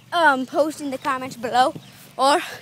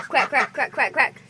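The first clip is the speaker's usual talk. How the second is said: duck quacking; 4 semitones lower than usual. Retold or imitated. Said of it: "One of them's actually quacking".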